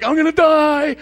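a preacher retelling a spittle scare with comic panic; mock panic. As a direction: cry it out like you've just been poisoned. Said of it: "it's like".

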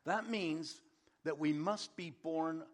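ordinary delivery

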